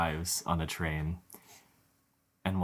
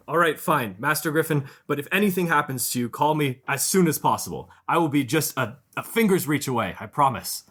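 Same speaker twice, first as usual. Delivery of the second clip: high, loud, anxious protector pleading with his master; worried bodyguard; 9 semitones higher, 8 dB louder than usual. Acted. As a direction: beg the young lord to be careful, pitched up and loud. Jason reluctantly lets Griff go.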